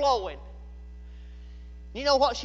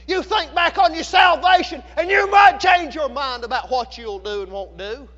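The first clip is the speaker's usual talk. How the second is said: excited, driving preacher's shout; full-force preaching; 5 dB louder than usual. Real, not acted. Preacher tells of everyone crying in the restaurant, in fervent shouts. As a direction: pound out each phrase with rising fervor.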